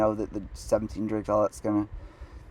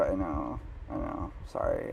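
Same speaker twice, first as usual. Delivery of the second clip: low, very soft acted apology; meek apology; 7 semitones lower, 11 dB quieter than usual. Man apologising to date for not being rich, meek. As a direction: shrink into a muttered, ashamed sorry.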